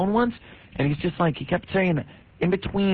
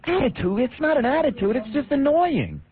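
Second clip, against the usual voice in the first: mocking; 9 semitones higher than usual. Real, not acted. Host mocks caller's attitude.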